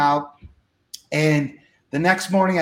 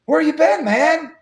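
high, lifted hail across a hall; acted calling out; 9 semitones higher, 3 dB louder, slower than usual. Promoter hails the speaker sneaking out, acted call. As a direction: call after someone slipping away.

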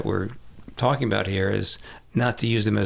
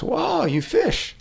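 mock admiration; much slower than usual. Salesman fakes admiration.

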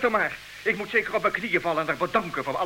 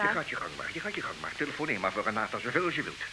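much softer and lower, icy; cold restraint; 8 semitones lower, 10 dB quieter than usual. Acted. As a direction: freeze her out with quiet contempt. Husband coldly dismisses wife.

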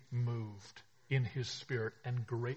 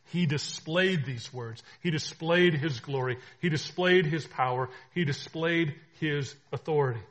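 loud ringing proclamation; preaching at full force; 3 semitones higher, 11 dB louder than usual. Acted. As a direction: declare it to the whole room.

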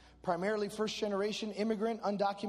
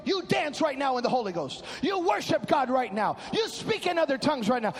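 forceful orders, growing louder; commanding; 4 semitones higher, 8 dB louder than usual. Retold or imitated. Dad tells people to praise, commanding. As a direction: imitate a pastor father giving commands. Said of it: "my dad commanded us".

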